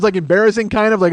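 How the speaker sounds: ordinary talk, nothing marked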